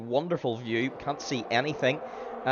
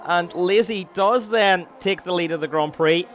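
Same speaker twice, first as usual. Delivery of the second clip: excited overtake call; 9 semitones higher, 8 dB louder than usual. Acted. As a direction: build it as the lead changes. Overtake for the lead.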